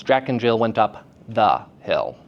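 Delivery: "the" set apart by pauses before "hill"; separated stress on the. Shown as a demonstration. Teacher demonstrating stress by separation, pauses round "the". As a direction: isolate "the" with a gap either side. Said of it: "separating the stressed syllable from its surrounding".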